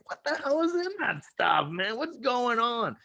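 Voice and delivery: high, whiny mock complaint, slowing on the end. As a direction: whine like a sick tough guy. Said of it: "I was like".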